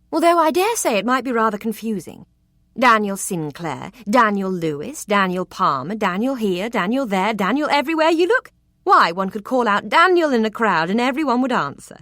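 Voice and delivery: high, loud, rising to a gleeful Daniel everywhere; a flirt teasing him about his name; playful mockery. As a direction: build the joke playfully. She mocks the name Daniel.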